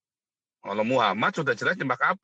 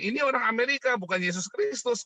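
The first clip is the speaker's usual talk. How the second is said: lightly voiced Chinese leader, higher; Jinping quote; 9 semitones higher than usual. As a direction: mimic Jinping dismissing it. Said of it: "katanya si Jinping".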